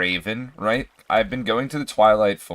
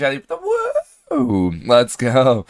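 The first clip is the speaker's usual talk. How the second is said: sudden high, loud whoop; excited whoop; 4 semitones higher, 6 dB louder than usual. Real, not acted. Streamer greets a viewer's check-in with a loud, high whoop. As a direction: yelp with joy at the check-in.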